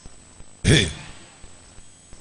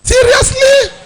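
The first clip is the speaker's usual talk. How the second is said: squealing delight; 13 semitones higher, slower than usual. Pretend. Preacher acting a flattered girl's squeal to the congregation. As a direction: play the flattered girl, shrieking with delight. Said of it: "Go tell like this".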